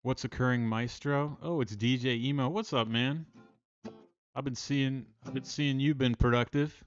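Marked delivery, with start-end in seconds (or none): none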